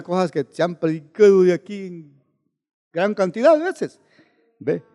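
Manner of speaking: ordinary talk, nothing marked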